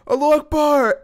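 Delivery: very high, loud scream; imitating Billy's victory yell; screamed Allahu Akbar. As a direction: yell it out at full volume. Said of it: "so he screams".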